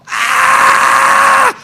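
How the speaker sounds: loud, high, sustained scream; re-enacted scream from the cell window. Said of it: "I'm screaming".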